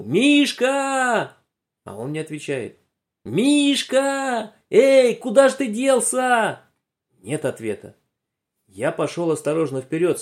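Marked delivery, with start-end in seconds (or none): 0.0-1.4 high, drawn-out call; calling shout. Acted. Boy calls for his vanished friend, a high shout. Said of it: "Я кричу". 3.2-6.6 high, slow, stretched calling; calling shout. Acted. Boy calls again to his missing friend, high drawn-out shout. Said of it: "Я кричу".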